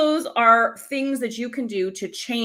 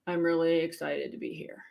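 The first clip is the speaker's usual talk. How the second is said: softer, lower, unconvinced; unconvincing excitement; 6 semitones lower, 8 dB quieter than usual. Shown as a demonstration. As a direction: say it like you don't mean it. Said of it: "Do you believe me with this face?".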